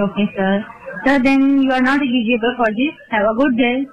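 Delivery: ordinary delivery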